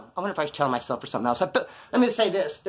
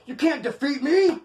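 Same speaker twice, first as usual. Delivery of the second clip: mock challenge; 10 semitones higher, slower than usual. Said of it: "he's like".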